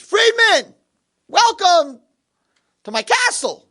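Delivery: high, loud hearty greeting in a retold story; cheery welcome. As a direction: greet him grandly, starting high and easing down. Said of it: "a very happy, nice person".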